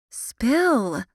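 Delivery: high, insistent command. Acted. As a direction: demand the gossip now. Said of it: "Lily commanded".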